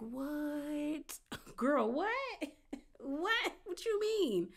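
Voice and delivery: high, breathless mock shock with laughter; stunned disbelief. Cast members hearing the car reveal, stunned and high.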